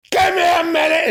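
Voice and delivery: hammed-up shouting parent losing it; mock angry yell. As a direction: snap it like a fed-up old-school dad. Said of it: "It's nothing like that".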